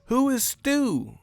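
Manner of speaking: high, squeaky disbelief; mock-baffled high voice; incredulous. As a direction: ask it like it's absurd. Disbelief at unknown fighter.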